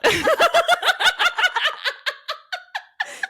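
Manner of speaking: long, high, loud shriek of laughter. Real, not acted. Cracking up over dad's stalk of corn line, shrieking laugh. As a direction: let the laugh climb way up and ring out.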